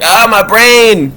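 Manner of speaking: high, slow, hammed-up cry of pain; mock anguish. Mocking heartbreak felt in the head, high and drawn out. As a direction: stretch out "Ah, my brain" in mock agony. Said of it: "grab your brain and be like".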